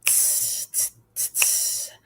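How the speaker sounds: soft tss hi-hat sound, unvoiced; cymbal hiss. Shown as a demonstration. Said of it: "Your turn".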